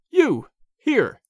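can't believe he's there; astonished. A narrator's reading. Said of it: "I exclaimed".

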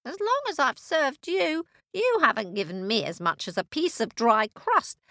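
very high, mewing complaint; indignant cat. Acted. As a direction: whine back like an aggrieved cat. Cat talks back to witch.

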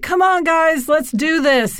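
retold upbeat encouragement; imitated pep talk. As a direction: cheer on tired colleagues. Boss rallying a worn-down team.

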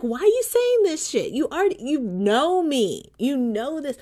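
high-pitched retold protest; indignant protest. Protesting to her sister on the phone, indignant. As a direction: push it high in mock indignation. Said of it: "I'm like".

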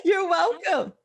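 high, playful reply through laughter; laughing. Real, not acted. Playful reply amid shared laughter.

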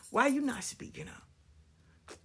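slow whispered question. Retold or imitated. Asking the silent women why, in a slow whisper. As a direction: breathe it out.

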